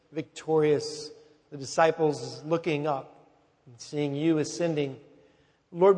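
low, softening pastoral prayer. Real, not acted. Pastor praying over the Gospel of Luke, low prayerful voice.

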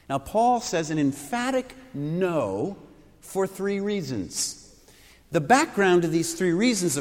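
normal talking voice

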